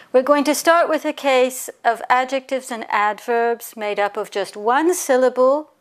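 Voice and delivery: normal talking voice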